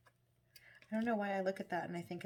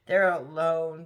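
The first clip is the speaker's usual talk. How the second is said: ordinary delivery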